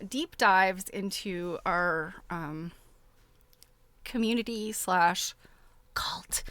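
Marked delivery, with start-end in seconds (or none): 5.9-6.4 a quick unvoiced whisper on the punchline; whispered aside. Real, not acted. Host whispering a joke to her viewers. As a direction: drop to a secret whisper for the joke.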